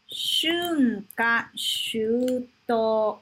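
Shunka shuto drawn out, each syllable long; very slow model pronunciation. Shown as a demonstration. Teacher showing students Shunka shuto, very slow and clear. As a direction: stretch Shunka shuto out very slowly. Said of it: "deixa eu te mostrar uma coisa".

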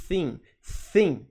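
"thin" as a lisped Portuguese "sim"; tongue-tied S. Shown as a demonstration. Teacher showing TH through sim, lisped "thin". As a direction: start "thin" with tongue caught, airy TH for S. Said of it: "pra falar sim com a língua presa".

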